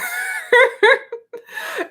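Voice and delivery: a long high giggle; high laugh. Real, not acted. Streamer laughing at comic book deaths, high giggle.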